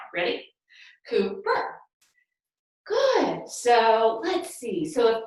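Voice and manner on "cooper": "Cooper" split in two, rising on the second beat; one beat per syllable. Shown as a demonstration. Teacher leading hip shakes to a name, "Cooper" split by syllable. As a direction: break "Cooper" into COO and PER. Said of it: "to each syllable of the sound".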